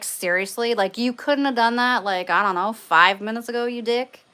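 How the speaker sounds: playfully outraged telling-off; mock indignant scolding. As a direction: tell off a prankster in mock annoyance. Acted scolding of the goat.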